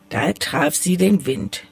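ordinary delivery, nothing marked

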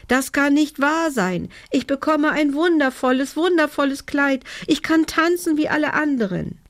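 very high, thrilled elf voice; overjoyed cry. A narrator's reading. Elf receives cloth for her dress, overjoyed. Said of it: "rief sie".

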